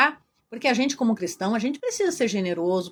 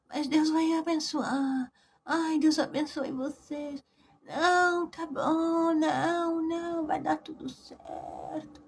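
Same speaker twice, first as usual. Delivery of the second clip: soft, slow, pitiful moaning voice, much softer; whiny sick patient; 4 semitones higher, 6 dB quieter, much slower than usual. Retold or imitated. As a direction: play the fragile suffering woman on the phone. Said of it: "vi ela falando no celular desse jeito". (mulher doente se fazendo de coitadinha ao telefone, voz fraca e chorosa)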